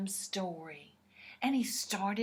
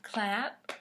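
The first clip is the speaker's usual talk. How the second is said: plain reading voice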